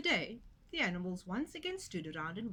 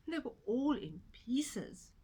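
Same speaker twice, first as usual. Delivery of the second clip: ordinary delivery, nothing marked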